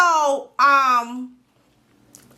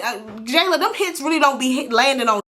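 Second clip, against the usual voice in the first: normal talking voice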